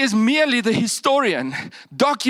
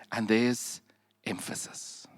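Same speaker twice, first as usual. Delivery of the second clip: breathy whisper for emphasis; 8 semitones lower, 11 dB quieter than usual. Real, not acted. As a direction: drop to a whisper on the key word. Preacher lowers voice for effect.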